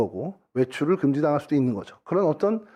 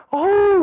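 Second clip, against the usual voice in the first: a loud, high exasperated "아우" groan; 8 dB louder, much slower than usual. Retold or imitated. Pastor imitating a congregant groaning at his weight, loud exasperated groan. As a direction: groan in mock disgust. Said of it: "그게 아우까지 할 일이냐는 거지".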